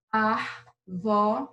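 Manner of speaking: "Arvore" as ar, vo, re with pauses; syllable by syllable. Shown as a demonstration. Teacher counting mouth openings, "Arvore" split in three. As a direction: break "Arvore" into three separate beats. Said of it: "Quantas vezes você abre a boca pra falar árvore?".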